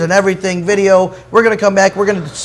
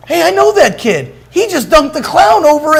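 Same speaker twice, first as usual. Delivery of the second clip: much higher, calling out in recognition; excited onlooker; 10 semitones higher, 4 dB louder than usual. Retold or imitated. One guy spotting that kid on Main Street, excited shout-out. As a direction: imitate a stranger hollering from the street. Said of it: "this one guy goes".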